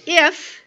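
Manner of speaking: "If" alone, high and loud; stress on IF. Shown as a demonstration. God often says it, "If" said emphatically. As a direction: punch "If" and leave it hanging. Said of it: "so the if is important".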